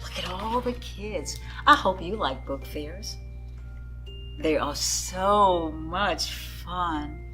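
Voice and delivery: usual speaking voice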